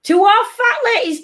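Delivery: high sing-song bingo call; bingo caller. Pretend. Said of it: "not two half fat ladies".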